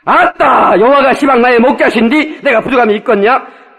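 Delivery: loud, high Jeolla-dialect recitation. Acted. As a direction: belt out scripture in broad Jeolla dialect. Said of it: "전라도 버전 다시 한번 읽어주려고 그래".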